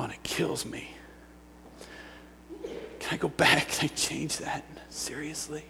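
hushed, halting plea to Jesus; very soft, pained soul-searching; regretful. Retold or imitated. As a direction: murmur it with long pauses, sick with regret. Regretful prayer looking back.

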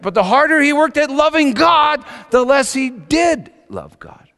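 loud, high, urgent sermon delivery; preaching at full force. Acted. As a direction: hammer the argument home. Preaching climax on sin.

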